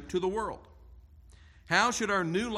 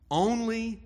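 only lifted higher and louder; stress on only; 5 semitones higher, 4 dB louder than usual. Shown as a demonstration. Preacher insisting only Christians are salt, stressed only. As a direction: punch the word only. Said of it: "stressing exclusivity".

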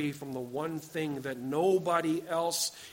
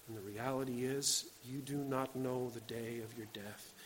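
low, hushed and grave; solemn appeal; 6 semitones lower, 12 dB quieter than usual. Real, not acted. Preacher tells young people of a friend's death, solemn and quiet. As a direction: drop right down and let it sink in.

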